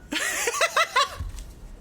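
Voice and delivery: very high giggling burst; high-pitched laughter, fading out; real laugh. Real, not acted. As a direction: giggle helplessly after owning up. Laughs after admitting eating cookies.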